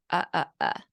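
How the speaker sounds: "uh, uh, uh" for umbrella, consonants dropped; three bare "uh" syllables; vowels only. Shown as a demonstration. Imitating a child saying umbrella as vowels only. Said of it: "three vowels".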